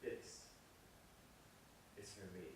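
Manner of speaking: quiet, heartfelt aside during a take; hushed dedication. Acted. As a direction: savour the moment under your breath. Murmured dedication while cameras roll.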